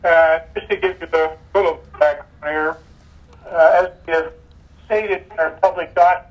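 breaking up over a bad connection; choppy cut off endings. Real, not acted. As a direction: audio dropping in and out. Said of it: "you're cutting out there a little bit".

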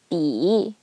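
"bi" dipping low, then rising; third tone. Shown as a demonstration. Teacher showing pinyin example, "bi" dipping then rising. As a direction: sink "bi" and lift it. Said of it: "third tone".

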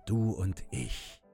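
plain reading voice

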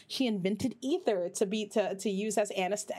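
ordinary talk

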